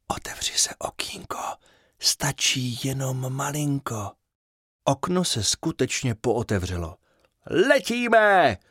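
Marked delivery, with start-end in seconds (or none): 0.1-4.1 soft breathy whisper, slowing at the end; whispered spell. Acted. Fairy whispering a spell to open the window, hushed. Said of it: "Bublenka zašeptala". 7.5-8.6 high bright call of delight; joyful exclaim. Acted. Fairy calling out as they fly off, high and excited. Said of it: "zvolala Bublenka".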